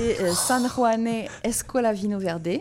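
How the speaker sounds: slow, careful attempt at a Portuguese accent on the wine name; self-conscious Portuguese accent on the wine label. Shown as a demonstration. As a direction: sound out the Portuguese name haltingly. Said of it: "horrible mon accent".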